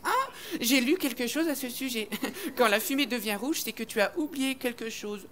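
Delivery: a mocking little know-it-all; know-it-all girl. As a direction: lecture like the class swot. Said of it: "Miss Je sais tout qui est insupportable, qui fait".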